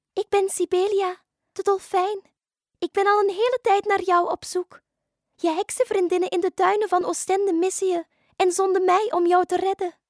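high, loud dolphin voice; friendly dolphin. Acted. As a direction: introduce yourself as a rescuing dolphin. (hoge, vriendelijke dolfijnenstem)

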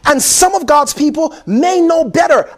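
ordinary talk

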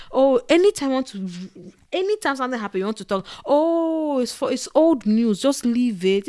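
high, loud brush-off of feelings; dismissive elder. Retold or imitated. African elder telling a child to just leave it, dismissive. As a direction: wave the problem away like an elder.

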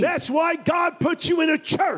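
fiery pulpit delivery, pushing every line; preaching at full force. Acted. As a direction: preach it hard to the congregation.

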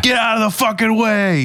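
high mock road-rage yell. Imitating the cop's road rage at the car. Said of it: "I'm leaning towards fucking".

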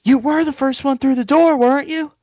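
high, loud mock of the clerk. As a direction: pin him down in a shrill put-on voice. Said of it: "then she goes".

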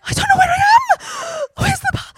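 high, loud lost cry in the dark; panicked shout. Acted. Someone lost in the dark shouting for the path, panicked. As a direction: yell like you're lost and can't find the path.

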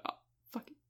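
whispered under the breath; a tossed-off whispered curse; muttered aside. Real, not acted. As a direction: drop to a whisper, giving up.

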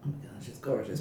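low, soft, secretly smitten; hushed secret awe. Retold or imitated. Leah hiding her feelings for Abby, hushed awe. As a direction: keep it quiet, like a secret crush. Voicing Leah's hidden longing.

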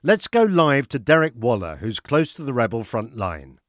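formal newsreader delivery; news read. Acted. News anchor reads bulletin.

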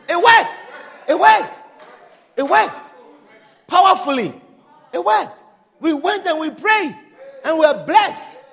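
joyful shout. Real, not acted. Preacher celebrating the prayer meeting that worked, jubilant. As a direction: shout the victory over and over.

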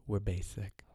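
ordinary talk

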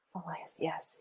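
barely voiced reminder; hushed self-talk. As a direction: repeat it to yourself under your breath. Repeats it to herself, daunted.

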